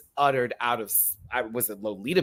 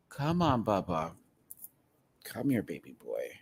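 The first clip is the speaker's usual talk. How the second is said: tender coaxing; 5 semitones lower, 5 dB quieter than usual. Real, not acted. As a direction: coax the cat gently.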